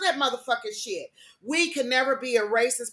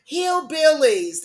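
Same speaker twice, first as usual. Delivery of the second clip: louder, higher, slow "Hillbillies."; loud repeat; 5 semitones higher, 5 dB louder, slower than usual. Shown as a demonstration. Hammering the word home to viewers, loud and slow. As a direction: punch "Hillbillies." out high and loud. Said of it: "what, I say".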